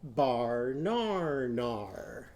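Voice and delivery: "Bar, nar, nar" with a long ar in each beat; ar vowel. Shown as a demonstration. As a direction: sing "Bar, nar, nar" like an aardvark's ar.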